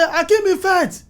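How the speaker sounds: "Akeem Ifet" high-pitched with the last vowel clipped off; mock the crew's high clipped call of "Akeem Ifet"; imitating the call. People on set copying the call, in a high mock voice. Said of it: "Some people now say".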